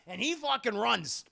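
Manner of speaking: usual speaking voice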